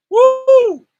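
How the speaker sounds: loud high woo of excitement; sudden joyful holler from the pulpit; excited whoop. Real, not acted. Preacher's excited outburst.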